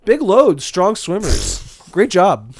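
deadpan, slow, loud serious voice; serious Danny DeVito. Retold or imitated. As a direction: say it dead seriously. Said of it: "very serious about it".

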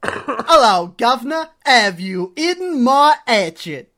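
loud, high, drawn-out mock English accent; hammed-up English accent. Shown as a demonstration. Co-host showing off a "real" English accent, hammed-up. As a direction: belt out a caricature English accent, slowly. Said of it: "warm up my real English accent".

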